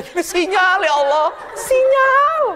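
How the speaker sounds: husband desperate his message is stuck; panicked husband. Retold or imitated. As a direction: wail at the lost signal. Husband panicking over signal.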